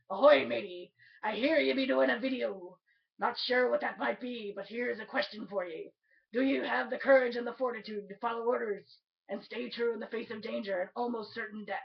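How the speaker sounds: pirate voice. Pretend. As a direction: read it out like a hearty mock pirate hailing a shipmate. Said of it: "in my best pirate voice".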